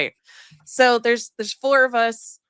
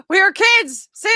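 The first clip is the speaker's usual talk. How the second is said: put-on high, loud "We were kids" blurted out; loud exclaim; 8 semitones higher, 7 dB louder than usual. Shown as a demonstration. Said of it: "Say it much slower".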